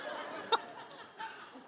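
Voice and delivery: breathless high laugh; a light unvoiced laugh. Real, not acted. Teacher laughs at his own joke to the class, airy laugh. After joking Q and A.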